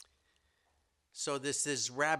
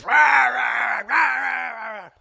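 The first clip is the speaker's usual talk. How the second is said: loud high roaring rah; roar of a fight; 14 semitones higher, 16 dB louder, much slower than usual. Acted. Noise from the cave as the lion is attacked, loud roaring. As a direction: roar out the offstage struggle full force. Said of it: "you hear this noise".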